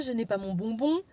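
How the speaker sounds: ordinary talk, nothing marked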